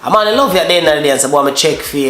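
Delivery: usual speaking voice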